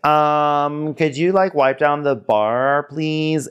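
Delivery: high, sweet put-on ask; lightly voiced prissy boss; a hint of a fake-sweet bartender; mock polite request. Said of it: "he's like".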